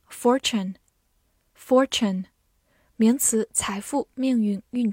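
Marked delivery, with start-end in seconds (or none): 0.1-2.3 American "fortune" with an r in "for"; American pronunciation. Shown as a demonstration. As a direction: say "fortune" the American way, r-coloured first syllable. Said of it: "美式发音".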